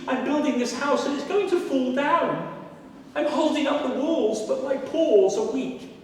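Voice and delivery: high, sweet-talking rabbit voice; a cunning rabbit flattering the jaguar, high and coaxing; sly rabbit. Acted. Rabbit coaxing the jaguar to hold the walls, high and sweet.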